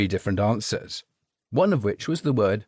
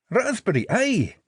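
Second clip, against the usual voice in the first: bright, higher Scottish voice; beaming Scottish; 9 semitones higher than usual. A narrator's reading. Jelly baby proudly answers Jinx, beaming Scottish. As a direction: beam with pride, in Scots. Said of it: "said Lennox, beaming".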